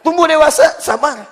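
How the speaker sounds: ordinary talk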